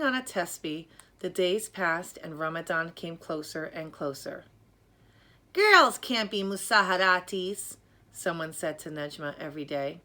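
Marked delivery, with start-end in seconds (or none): 5.5-7.8 loud, dismissive scolding; scornful voice. Acted. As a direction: tell her flatly she can't. Naysayers scold Nejma.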